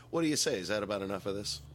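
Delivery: ordinary talk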